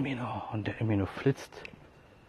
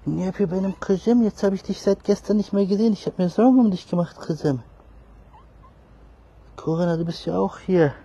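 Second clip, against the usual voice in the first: high pet talk; 10 semitones higher, 8 dB louder than usual. Real, not acted. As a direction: gush over the lost cat in a high voice. High loving talk to cat. (hohe, laute Babysprache zur Katze)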